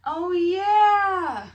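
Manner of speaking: high, loud excited cry; excited shout. Real, not acted. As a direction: burst out as it comes back to you. Suddenly remembering the book's edges.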